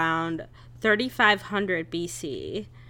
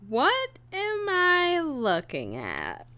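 slow, high, drawn-out question; baffled; 9 semitones higher, 4 dB quieter, slower than usual. Acted. Listener puzzled by the statue, mock baffled. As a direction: stretch out the puzzlement in mock bewilderment.

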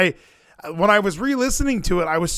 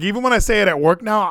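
ordinary talk

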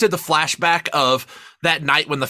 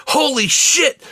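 stunned holy shit; 10 semitones higher, slower than usual. Retold or imitated. Podcaster reliving a movie twist, high shocked exclaim. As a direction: blurt it out like the twist just hit you. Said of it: "I was like".

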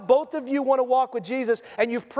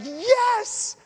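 excited yes; 9 semitones higher than usual. Acted. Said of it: "John's like".

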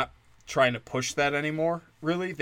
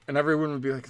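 ordinary delivery

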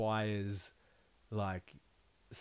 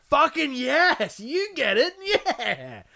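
ecstatic shout; 10 dB louder, much slower than usual. Retold or imitated. Stranger in mosh pit.

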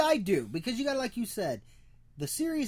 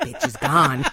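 laughing; 2 semitones higher, 6 dB louder, much slower than usual. Real, not acted. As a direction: crack up while getting the words out. Both hosts crack up.

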